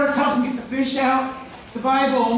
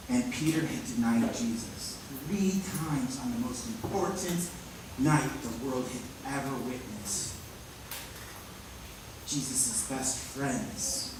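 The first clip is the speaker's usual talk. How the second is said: much lower and softer, hushed; somber; 9 semitones lower, 11 dB quieter than usual. Acted. Preacher reflecting on Peter's betrayal, hushed and low. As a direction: drop down into sorrow.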